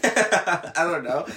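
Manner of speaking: slow words through a laugh; sheepish laughing shrug. Real, not acted. Laughing after admitting bar mischief.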